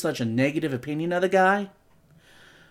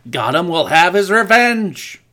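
loud villain declaration; 8 dB louder than usual. Acted. Villain line quoted.

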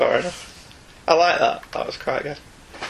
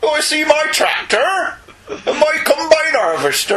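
put-on higher, laughing Northern farmer accent; Northern Vader; 10 semitones higher than usual. Co-host voices a farmer Vader in a Northern accent. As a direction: Vader as a Northern farmer admiring his combine harvester. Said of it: "What accent you're doing?".

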